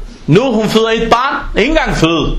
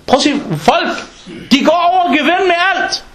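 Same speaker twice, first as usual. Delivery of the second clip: exasperated outburst; 10 semitones higher than usual. Real, not acted. Exasperated outburst about people.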